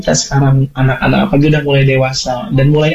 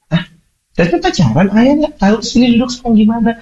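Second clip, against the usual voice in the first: surprised father; 9 semitones higher than usual. Retold or imitated. Father reacting calmly to daughter.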